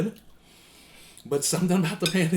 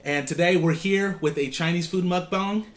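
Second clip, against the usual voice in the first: loud, upbeat channel welcome; vlog intro; 6 semitones higher, 6 dB louder, slower than usual. Acted. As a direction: greet the viewers like a host. Opens the vlog.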